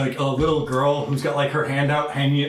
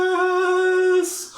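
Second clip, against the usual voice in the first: very high, gleeful yes; eager child; 3 dB louder than usual. Acted. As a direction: shout it like a thrilled kid. GM voices a child.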